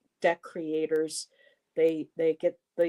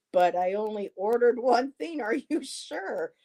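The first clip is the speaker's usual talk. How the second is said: worried disbelief; 6 semitones higher than usual. Retold or imitated. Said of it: "'cause I kinda got scared".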